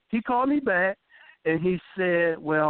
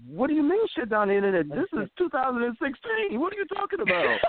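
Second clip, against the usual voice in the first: retold incredulous question; 8 semitones higher than usual. Told he is shutting down the internet, rising incredulous retort. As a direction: pitch climbing higher in mock disbelief. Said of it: "And I said".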